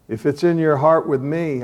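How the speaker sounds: ordinary talk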